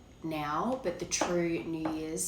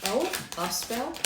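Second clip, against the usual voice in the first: surprised; 6 semitones higher than usual. Real, not acted. Pulls a card, surprised.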